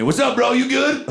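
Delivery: high friendly bro voice; naked stranger. Retold or imitated. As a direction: answer casually from the tree. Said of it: "His voice comes back at me".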